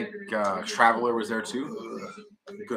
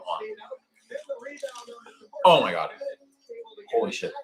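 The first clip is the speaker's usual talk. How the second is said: stiff, pained exclamation; straining to stand; slower than usual. Real, not acted. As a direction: groan as stiff legs take weight. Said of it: "I'm gonna try standing up now".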